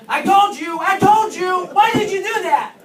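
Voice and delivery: high, very loud pretend scolding yell; hammed-up rage at a patient who wouldn't listen; jokingly yelling I told you at a stubborn patient; mock furious shout. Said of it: "and just say".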